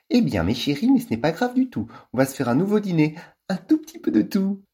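high, breezy and a bit irritated; airy girl voice. Acted. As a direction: put on a flighty society girl. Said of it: "la voix un peu désinvolte, un peu énervée et trop haut perché".

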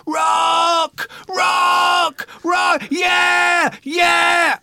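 very high, loud screamed whoops; freak-out shouting. Retold or imitated. Imitating his tripping friend running round the cinema, freak-out shouting. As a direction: imitate a frenzied fan screaming at full force. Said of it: "He was having a freak out".

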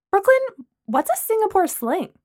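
chirpy and curious; Lana. Acted. Lana asking Brooklyn about the drink.

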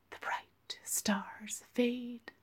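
slow, soft breathy whisper. A narrator's reading. Bald bothered man murmuring to Miss Juice, slow whisper. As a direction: breathe the words out slowly. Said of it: "he whispered".

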